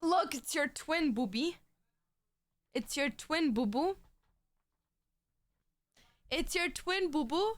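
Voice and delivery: pet talk. Real, not acted. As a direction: coo sweetly at the pet. Cooing to a pet.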